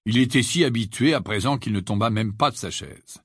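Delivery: plain reading voice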